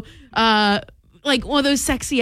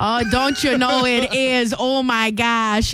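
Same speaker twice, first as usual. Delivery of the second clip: slow, stretched Minnesota vowels; hammy Minnesota accent, slowly; Minnesotan accent; slower than usual. Acted. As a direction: drag it out as a Minnesotan. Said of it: "very darn close to Minnesotan".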